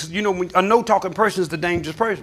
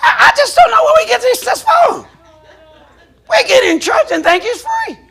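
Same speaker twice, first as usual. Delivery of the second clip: much higher, louder preaching cry; preacher shouting at the congregation about tithes; 10 semitones higher, 6 dB louder than usual. Real, not acted. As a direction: preach it at full force. Preacher at full force.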